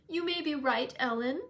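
lightly voiced young lady, earnest; Cathy's voice. A narrator's reading. Cathy speaking to Ellen.

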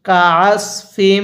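কাসফিম drawn out slowly; slow recitation. Shown as a demonstration. Teacher reciting for students to repeat. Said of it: "আমার সাথে পড়ুন".

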